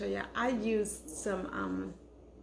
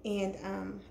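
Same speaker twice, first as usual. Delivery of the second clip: ordinary talk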